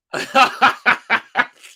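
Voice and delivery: high, loud helpless laugh; loud fan laughing at a blunder; burst of laughter. Real, not acted. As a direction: crack up out loud. Laughing at a slip.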